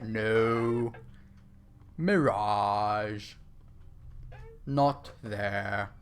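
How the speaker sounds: put-on lightly posh English accent, dismissive; a hint of a posh English correction; calm posh English put-down, mock-lofty. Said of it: "my posh English accent voice".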